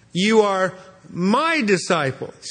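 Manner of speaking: slow, with MY drawn out and lifted; stress on MY. Shown as a demonstration. Preacher quoting Jesus to the congregation, stressing MY. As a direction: set off "my" and lean on it. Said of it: "an emphasis on the word my".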